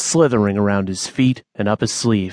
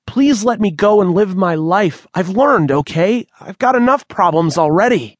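frightened begging; 8 semitones higher, 3 dB louder than usual. Acted. Manny begging to be freed.

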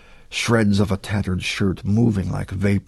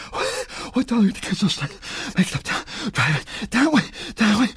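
panic; 11 semitones higher than usual. A narrator's reading. Panicked boy fends off attack.

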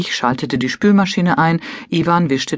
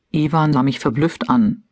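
usual speaking voice

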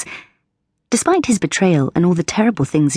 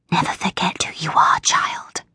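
unvoiced ghostly whisper of many spirits; 4 dB quieter than usual. A narrator's reading. Oracle spirits warn Elle in a ghostly whisper. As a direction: breathe it like a chorus of ghosts.

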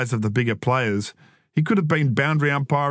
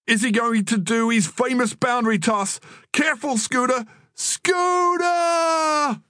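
high, hyped-up commentator; frantic ringside call, climbing higher; joining in commentary; 11 semitones higher than usual. A narrator's reading. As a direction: build mock alarm to a long, high cry. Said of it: "Troy joined in".

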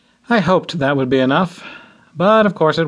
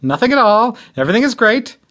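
insisting; 8 semitones higher, faster than usual. A narrator's reading. Groom insisting to his mom that all is fine, higher. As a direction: protest too much that all is well. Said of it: "I insisted".

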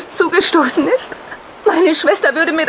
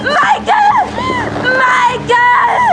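shrill screaming of his name; horrified scream; much slower than usual. Acted. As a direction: scream in horror at the loss. Husband is shot.

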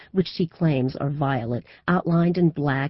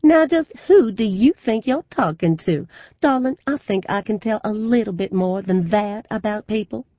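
gushing southern belle; 7 semitones higher, 6 dB louder than usual. A narrator's reading. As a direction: play the Southern belle for the crowd. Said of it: "She explodes with laughter".

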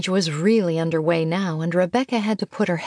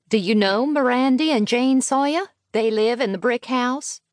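higher-pitched mother, asking a favour; a touch of rural chattiness, pitched up; lightly voiced country mother; 6 semitones higher than usual. A narrator's reading. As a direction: lightly play a mother making enquiries.

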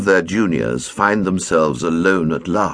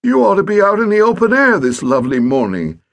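higher, faster, weak coaxing; feeble suggestion; 12 semitones higher, 5 dB louder, faster than usual. A narrator's reading. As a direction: try to shoo the boy outside. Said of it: "he said feebly".

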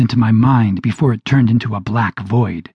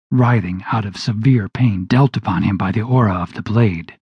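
ordinary delivery

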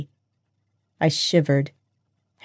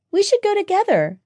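very high, eager suggestion; excited; 3 dB louder than usual. Acted. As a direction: propose it brightly in a very high voice. Excited friend keeps urging her.

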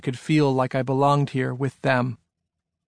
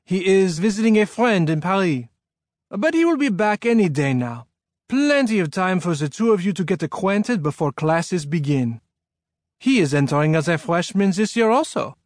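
put-on higher-pitched French-accented man; 5 semitones higher than usual. A narrator's reading. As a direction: introduce yourself in a French accent. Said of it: "in a French-sounding accent".

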